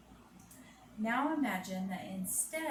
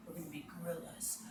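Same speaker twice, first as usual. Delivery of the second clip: fast, softer secret whisper; 4 semitones lower, 8 dB quieter, much faster than usual. Acted. Teacher whispering to kids before the gorilla pose. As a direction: build suspense in a hushed voice.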